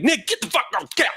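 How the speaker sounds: very high, fast mock yell; cuss mode. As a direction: snap at him, cursing. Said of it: "keeps me from being like".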